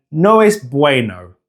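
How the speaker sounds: "No es bueno" in an English accent, noh ess BWAY-noh; English-accented "No es bueno" with gliding OH and AY. Shown as a demonstration. Teacher imitating a beginner, English-accented "No es bueno". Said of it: "with an English accent".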